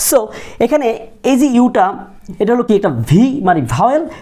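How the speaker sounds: ordinary talk, nothing marked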